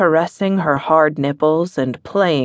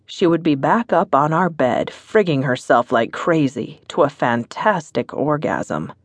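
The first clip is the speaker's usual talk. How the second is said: ordinary delivery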